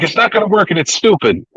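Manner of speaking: high retold objection; imitated fed-up protest; mock exasperation. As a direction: reject the plan, hamming it up. Retelling partner's exasperated refusal.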